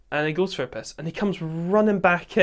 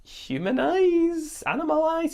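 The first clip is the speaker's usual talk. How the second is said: high panicked questions; 13 semitones higher, much slower than usual. Retold or imitated. Retelling the night, asking a friend about eyes in the trees, high and slow in alarm. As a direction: voice jumps way higher, slowed right down, asking in alarm. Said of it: "And I was like".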